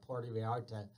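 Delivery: ordinary delivery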